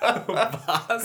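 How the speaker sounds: high, loud, laughing disbelief; shocked. Real, not acted. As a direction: shriek it in shock. Shocked at story.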